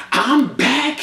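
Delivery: imitating singer plugging her music. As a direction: brag it to the camera. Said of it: "She like".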